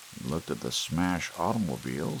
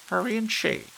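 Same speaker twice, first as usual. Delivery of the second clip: bossy wife; 10 semitones higher than usual. A narrator's reading. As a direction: boss him around. Wife ordering husband around.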